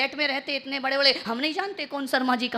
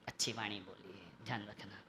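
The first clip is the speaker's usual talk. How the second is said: very low, very soft; quiet closing admonition; hushed counsel; 21 dB quieter than usual. Real, not acted. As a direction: drop the voice right down. Preacher drops to soft advice.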